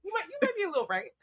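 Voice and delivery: high, quick, grudging admission; playful concession. Acted.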